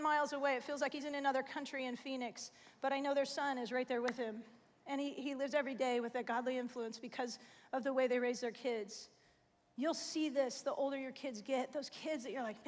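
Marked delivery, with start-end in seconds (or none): none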